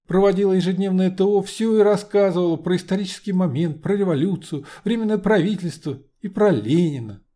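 put-on high, chatty young woman; girlish maid voice.

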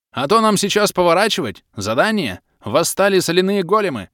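high, loud booming half-orc over the wind; big friendly brute shouting cheerfully; hearty half-orc. A narrator's reading. (полуорк Бом добродушно орёт на ходу)

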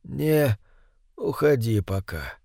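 weak, barely voiced old man; faint whisper. A narrator's reading. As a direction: breathe the word out weakly. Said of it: "слабо прошептал старик".

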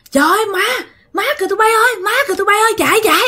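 imitated high-pitched alarm cry; panicked cry. Friends spot something in the orchard and yell to run, panicked cry. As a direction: act out friends raising the alarm, voice shooting way up. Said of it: "la làng lên".

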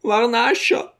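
high, pleading call of his name; an old mother calling out in shock; old mother's stricken cry. A narrator's reading. Mother crying out to her son, high and stricken. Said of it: "rief sie".

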